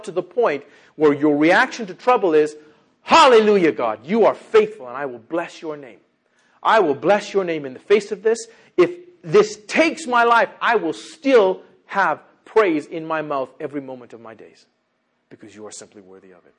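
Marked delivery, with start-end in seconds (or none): 3.0-14.1 loud declaration of faith, raised voice; exultant praise. Acted. Believer praising God in the face of trouble, exultant praise. 14.1-16.5 sudden low, soft aside to God; hushed devotion. Acted. Believer tells God He is worthy, hushed devotion. Model prayer drops to hush.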